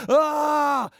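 high, drawn-out yell; mock crowd scream. Two million people screaming before God, high acted scream. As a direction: scream it like a frenzied mob. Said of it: "they were screaming out loud".

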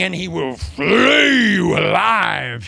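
slow shouted prophecy; fiery prophet shout. Retold or imitated. John the Baptist preaching at the crowd, slow shout. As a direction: thunder it out slowly.

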